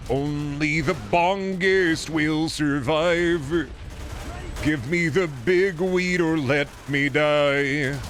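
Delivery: slow, drawn-out mock solemn; dramatic proclamation. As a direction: proclaim it like a prophecy. Hammed-up stoner proclamation.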